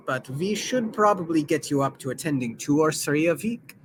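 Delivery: put-on accented, measured explanation; courteous mentor with a foreign accent; formal steward with accent. Sazed explaining the young nobility to his mistress, formal and accented.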